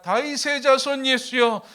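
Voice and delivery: high, loud imitated cry; loud shouting. Blind beggar shouting to Jesus over the crowd, loud imitated cry. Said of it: "크게 소리 지릅니다".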